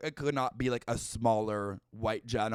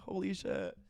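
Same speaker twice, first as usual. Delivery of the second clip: ordinary talk, nothing marked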